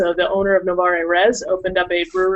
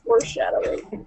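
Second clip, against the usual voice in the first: whispered aside; 7 dB quieter than usual. Acted. Ominous aside about the glass.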